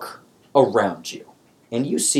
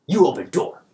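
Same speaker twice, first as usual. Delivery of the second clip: goblin command; 6 semitones higher than usual. Acted. Krieg orders.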